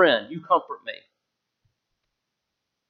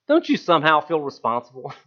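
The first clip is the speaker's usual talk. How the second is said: ordinary delivery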